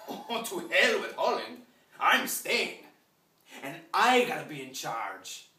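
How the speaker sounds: loud, slow, high-pitched declaration; blustering colonist. Acted. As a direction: bellow it out, stretching the words, full of entitlement. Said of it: "showed up one day in darkest Africa and said".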